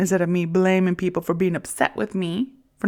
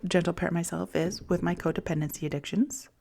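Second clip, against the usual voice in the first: normal talking voice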